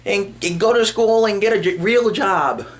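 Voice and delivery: high, mocking scold; scolding mom. Mom telling Speed to get a real job, high mocking scold. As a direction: nag him like a disapproving mom. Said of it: "he was like".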